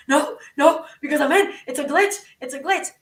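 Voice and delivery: re-enacted pushback, starting loud; insistent protest. Applicant arguing with her doubting friend, insistent protest. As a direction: refuse to accept it, hammering each short phrase.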